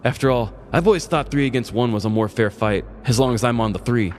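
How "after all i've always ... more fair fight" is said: plain reading voice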